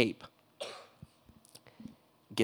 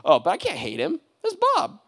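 high, louder fond protest; rising, high affectionate protest; 12 semitones higher, 6 dB louder than usual. Retold or imitated. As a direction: defend your friend Bob.

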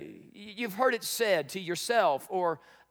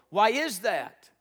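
normal talking voice

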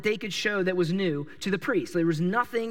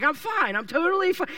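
unconvincing insistence; 8 semitones higher than usual. Retold or imitated. Sick people faking health.

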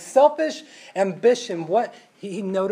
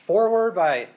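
usual speaking voice

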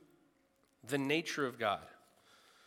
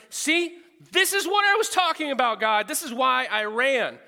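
much higher and louder, triumphant complaint; Jonah's angry I-told-you-so; 11 semitones higher, 11 dB louder than usual. Acted. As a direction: throw it in God's face, vindicated. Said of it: "so blinded by his anger".

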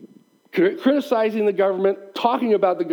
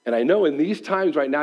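ordinary delivery

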